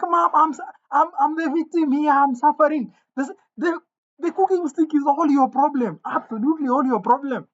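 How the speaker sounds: high-pitched, indignant self-defence; aggrieved protest. Acted. Beaten son blames mother for broken cooking stick, indignant.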